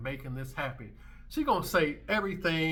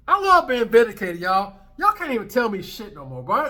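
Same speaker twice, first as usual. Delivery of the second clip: triumphant shout; 7 semitones higher, 13 dB louder than usual. Real, not acted. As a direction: crow it to the whole audience. Gloating shout again.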